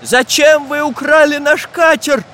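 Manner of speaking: high, slow, indignant demand; angry questioning. A narrator's reading. Самоделкин confronts the thieving pirates, angrily. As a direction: demand an answer crossly. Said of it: "зло спросил Самоделкин".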